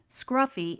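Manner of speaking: ordinary delivery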